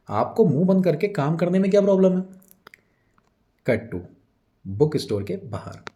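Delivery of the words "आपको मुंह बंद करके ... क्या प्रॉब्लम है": raised, high-pitched telling-off; scolding. Acted. Prabhat snaps back at Vijay, scolding. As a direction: scold him sharply, pitched up. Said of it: "विजय को डांटते हुए reply करता है".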